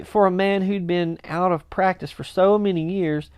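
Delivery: ordinary delivery, nothing marked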